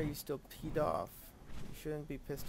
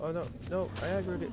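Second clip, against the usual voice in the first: higher, louder panicked cry; 1 alarmed; 6 semitones higher, 5 dB louder, faster than usual. Real, not acted. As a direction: blurt it out in sudden alarm. Panics as the beetle turns hostile.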